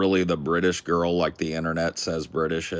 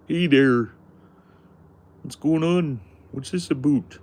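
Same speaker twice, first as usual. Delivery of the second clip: high, loud hammed-up Canadian accent; cheery over-the-top Canadian, pitched well up; mock Canadian accent; 10 semitones higher, 7 dB louder than usual. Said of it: "Canadian, huh?".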